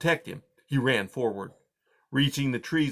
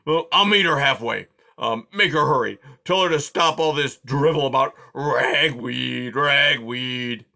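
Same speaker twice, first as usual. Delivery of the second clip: loud, grumbling porcupine growl; 3 semitones higher, 6 dB louder than usual. A narrator's reading. Aerith grumbling about Poppy's ragweed talk, growling. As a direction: growl out your complaint. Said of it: "he growled".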